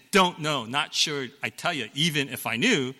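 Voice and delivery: ordinary talk, nothing marked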